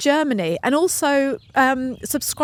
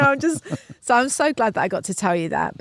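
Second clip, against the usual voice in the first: ordinary talk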